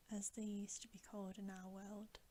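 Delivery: normal talking voice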